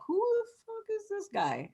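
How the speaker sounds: high, hushed disbelief; mock bewilderment. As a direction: imitate her baffled question under the breath, high-pitched. Voicing her bewilderment.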